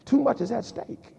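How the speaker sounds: softly consoling a grieving widow; calm, quiet reassurance to a crying wife; gentle comfort. Retold or imitated. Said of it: "I said".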